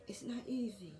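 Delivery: a choked, tearful whisper; holding back tears. Real, not acted. Woman with cancer fighting tears on camera, choked whisper. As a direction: barely get it out, fighting not to cry. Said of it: "I'm gonna try not to cry".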